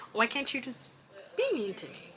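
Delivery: normal talking voice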